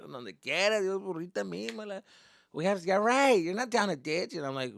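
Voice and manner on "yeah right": ordinary delivery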